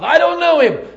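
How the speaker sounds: loud, high shouted denial; scared man shouting to disown a friend; panicked denial, acted. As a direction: blurt it out at full volume.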